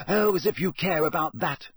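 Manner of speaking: mocking him back; scoffing. A narrator's reading. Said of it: "scoffed Hermione".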